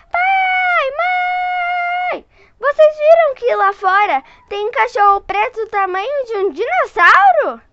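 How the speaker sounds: high, loud call to her parents; girl calling her parents about the huge dog; alarmed shout. Acted. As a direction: shout for mom and dad, scared.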